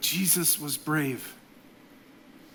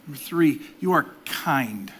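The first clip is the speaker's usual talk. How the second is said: ordinary talk, nothing marked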